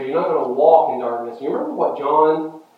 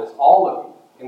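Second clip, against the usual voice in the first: usual speaking voice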